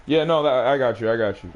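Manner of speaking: usual speaking voice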